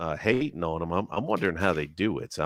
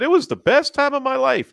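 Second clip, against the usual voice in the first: high, louder, gushing imitation; sky-high mock gush of a happy expat; mock quoting Richard; 7 dB louder than usual. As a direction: echo his words with exaggerated enthusiasm. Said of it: "And you just said".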